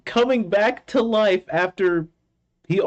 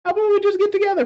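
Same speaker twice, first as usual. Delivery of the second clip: flirty mock voice; 11 semitones higher, much faster than usual. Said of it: "and then they were like".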